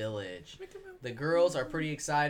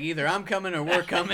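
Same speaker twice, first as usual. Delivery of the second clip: fast, louder imitation; mocking quote; 5 semitones higher, 6 dB louder, faster than usual. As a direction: mimic the line quickly. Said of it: "he's like".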